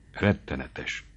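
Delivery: hushed, soft shudder of dread; a frightened girl shivering at the thought. A narrator's reading. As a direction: say it with a shiver, quietly. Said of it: "szólt Mari megborzongva".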